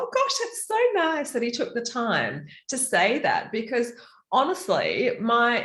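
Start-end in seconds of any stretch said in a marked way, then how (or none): 0.0-3.5 higher, touched inner voice; pleased and a little moved; a lightly voiced happy surprise. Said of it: "I thought".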